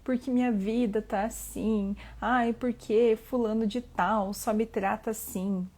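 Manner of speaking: mock complaining. Imitating people who only moan about their life, mock whiny complaining. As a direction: act out a grumbler dragging out each "ai". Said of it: "fica só reclamando".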